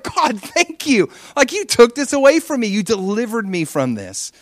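high, slow, thankful prayer; grateful joy. Retold or imitated. Young man thanking God for freeing him, high and slow. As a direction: pour out thanks to God, elated. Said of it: "I was so happy".

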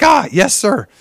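high, sudden "Ah, yes, sir."; startled correction. Retold or imitated. Teen caught by his coach, blurting a high startled yes sir. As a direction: jump up in pitch as you catch yourself.

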